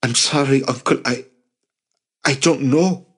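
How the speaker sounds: high, halting apology; confused and defeated. A narrator's reading. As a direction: falter helplessly through the apology. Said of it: "confused and defeated".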